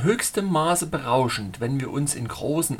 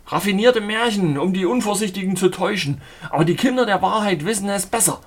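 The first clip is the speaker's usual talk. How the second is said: raised, brisk argumentative voice; insistent denunciation; 6 semitones higher, 5 dB louder than usual. A narrator's reading. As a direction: denounce it in a rising huff. Stubborn denial in an argument.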